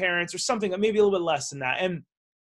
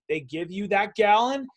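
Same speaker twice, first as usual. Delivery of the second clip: ordinary talk, nothing marked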